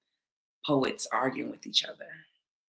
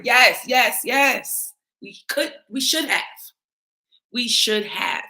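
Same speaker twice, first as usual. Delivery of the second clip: emphatic conceding; 7 semitones higher, 5 dB louder, slower than usual. Real, not acted. Emphatically conceding to critics' complaint.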